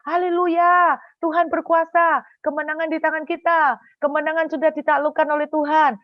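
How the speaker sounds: high, loud victory cry; triumphant shouting. Retold or imitated. Israelites shout victory at Jericho's wall, triumphant high shout. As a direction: proclaim it like a war shout, easing slightly. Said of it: "mereka teriak gini".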